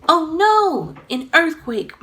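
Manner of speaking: high and loud startled alarm; alarmed cry. A narrator's reading. Mommy alarmed by the quake, high and loud. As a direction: gasp it out in fright. Mother feels ground shaking.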